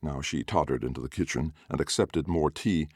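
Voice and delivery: usual speaking voice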